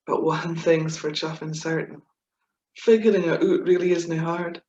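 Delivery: lively comic verse reading; poem recitation. Acted. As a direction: perform the poem with gusto. Reciting her comic Scots poem.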